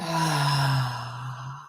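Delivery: a long, low, soft sigh out; long slow exhale. Shown as a demonstration. Yoga teacher modelling a long slow exhale for the class. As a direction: breathe it all out slowly on a sigh. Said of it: "a long, slow exhale".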